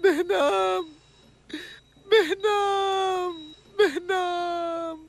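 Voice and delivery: slow, drawn-out sobbing name; crying his name. Acted. Companion weeping over the unconscious Behnam, drawn-out crying. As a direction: stretch the name out through tears.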